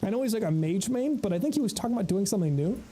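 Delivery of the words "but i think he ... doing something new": normal talking voice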